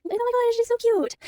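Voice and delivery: high-pitched gushing squeal; adoring coo. Real, not acted. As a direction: coo over something adorable, way up high. Gushing over a sleeping cub.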